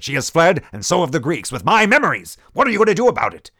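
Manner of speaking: rising rage, demanding action now; furious outburst. Acted. General berating De Beer and Asim over the fled djinn, furious shout. General erupts, dismissing their musings.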